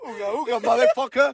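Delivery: high goofy caveman voice. Pretend. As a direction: grunt like a caveman. Playing cavemen together.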